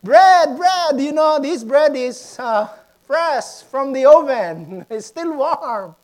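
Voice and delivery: loud street-seller patter; translated vendor call. Retold or imitated. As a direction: call out your fresh bread to the street.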